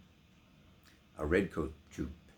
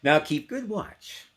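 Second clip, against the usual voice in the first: mocking soldier; 9 semitones higher, 9 dB louder than usual. Soldiers mock Bess.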